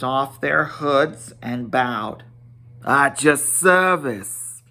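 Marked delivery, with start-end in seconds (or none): 2.8-4.6 bowing dwarfs. A narrator's reading. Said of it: "They both swept".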